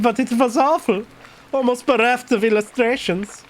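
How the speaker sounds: German character voice; German accent. Acted. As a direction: play Klaus with a put-on German accent. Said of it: "I was doing a fucking German voice for".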